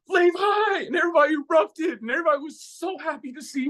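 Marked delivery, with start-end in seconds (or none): 0.1-0.9 high, joyful welcome shout of his name; excited group shout. Retold or imitated. Family cheering as Levi walks in the door, joyful shout. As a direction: cheer his name like a crowd greeting him. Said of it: "the whole house went".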